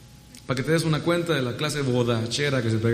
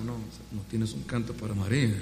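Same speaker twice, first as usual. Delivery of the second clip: lower, quieter, stern interrogation; Gestapo style; 4 semitones lower, 7 dB quieter than usual. Retold or imitated. Stricter organizers demanding a song for Mary, stern. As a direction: lean in like a cold interrogator. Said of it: "así, más Gestapo".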